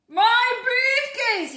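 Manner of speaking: high, loud, slow wail; alarmed cry. Acted. Mister Brown crying out for his lost briefcase. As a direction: cry out in dismay.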